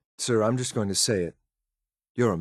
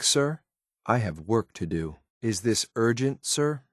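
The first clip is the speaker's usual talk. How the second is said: ordinary talk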